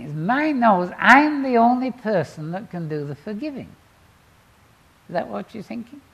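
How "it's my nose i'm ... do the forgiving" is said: indignant listener. Pretend.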